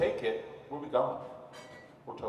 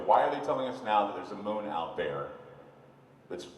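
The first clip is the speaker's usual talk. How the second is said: ordinary delivery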